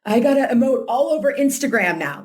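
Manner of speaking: high-pitched all-or-nothing overreaction; mock panic. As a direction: play the overeager survivor self. Acting out all-or-nothing survivor self.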